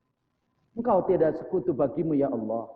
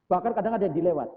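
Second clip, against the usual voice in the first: usual speaking voice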